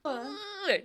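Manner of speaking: long high groan; high sympathetic groan. Real, not acted. Game master reacts to failed shot, high groan. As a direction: groan along with the unlucky player.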